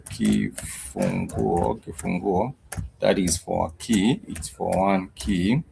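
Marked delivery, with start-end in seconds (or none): 0.0-1.8 kifunguo stretched out, ki-fu-ngu-o; slowed-down kifunguo; slow drilled kifunguo. Shown as a demonstration. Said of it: "we say kifunguo". 1.8-2.5 kifunguo said slowly, syllable by syllable: ki-fu-ngu-o; Swahili word kifunguo. Shown as a demonstration. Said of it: "we say kifunguo".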